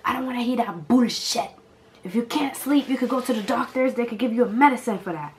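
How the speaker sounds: put-on loud, high Haitian-accented lecture; a furious father laying down the law; imitating Haitian dad. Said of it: "he's just like".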